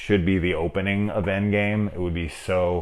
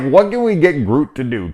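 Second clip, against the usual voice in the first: a mock brainstorming writer, pitched way up and louder; 9 semitones higher, 7 dB louder than usual. Imagined writers asking how to use Groot, in a mock high voice. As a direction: pitch the question like a clueless writer. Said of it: "It was like".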